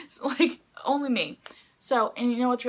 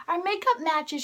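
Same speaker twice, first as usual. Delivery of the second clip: high-pitched imitated delight; delighted discovery; 8 semitones higher than usual. Singer noticing fan's matching makeup, high delight.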